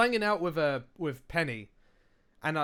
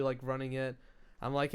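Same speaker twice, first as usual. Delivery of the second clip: ordinary delivery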